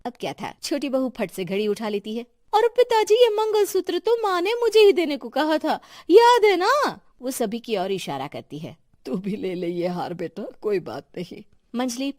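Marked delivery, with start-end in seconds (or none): 2.5-7.0 high, loud, insistent daughter-in-law; shrill-free loud demand, staking her claim; loud grabbing claim. Acted. Daughter-in-law loudly claims the necklace from father-in-law, insistent.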